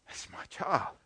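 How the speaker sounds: breathy hushed whisper of delight; whispered tenderness. Acted. God over the unborn child: a tender whisper. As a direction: breathe it like a secret joy.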